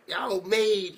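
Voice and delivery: high, loud mock rant; acted excited outburst. Otaku ranting about his new maid, excited mock outburst. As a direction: exclaim it high, playing the otaku.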